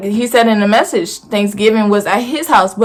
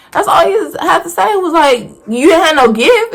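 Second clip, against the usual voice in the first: raised, incredulous retelling; indignant disbelief; 8 semitones higher than usual. Retold or imitated. Complaining to friends about dad's fake gift, incredulous. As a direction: scoff at the empty promise of a gift.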